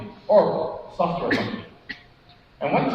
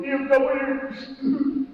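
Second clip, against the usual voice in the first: drawn-out, mock-tearful BMW at a higher pitch; mock wailing; 8 semitones higher, much slower than usual. Laid-off friend mock-wails about losing his company car, stretched and high. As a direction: stretch BMW into a hammed-up wail.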